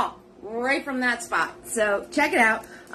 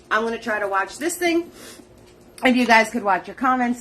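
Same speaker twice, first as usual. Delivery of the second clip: ordinary talk, nothing marked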